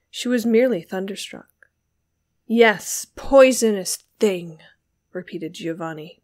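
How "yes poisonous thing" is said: loud, forceful accusation; beside oneself, passionate. A narrator's reading. Giovanni repeating his insult to Beatrice, beside himself. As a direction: hurl the insult with furious weight. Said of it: "beside himself with passion".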